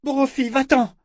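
high, loud warning call; desperate shout. A narrator's reading. Callan screams at Brophy to run, shouting. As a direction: yell at the wolf to flee. Callan warns her wolf.

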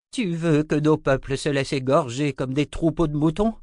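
a furious grandfather rebuking his grandson; angry old wizard. Acted. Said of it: "s'empourpra de colère". (vieux sorcier en colère, plus aigu et plus fort)

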